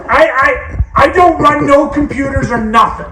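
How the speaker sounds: high, loud defiant protest; an old man insisting he does no computers; indignant dad shout. Retold or imitated. Dad protesting to phone lady.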